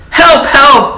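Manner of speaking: shrill high cry for help. A narrator's reading. Old mouse yelling for help with pants down. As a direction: yell it in a panic. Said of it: "cried the old mouse".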